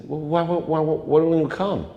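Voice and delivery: high, stammering disbelief; bewildered, high-voiced asking; baffled pleading. Baffled at friend's refusal.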